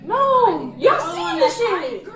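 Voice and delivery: loud, high, drawn-out yelling; real angry protest. Real, not acted. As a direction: shout your denial over the others. Heated argument in front of officer.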